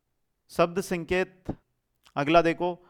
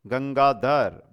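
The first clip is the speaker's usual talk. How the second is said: slow; 4 semitones lower, much slower than usual. Shown as a demonstration. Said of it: "Gangadhar में कौन सा समास है".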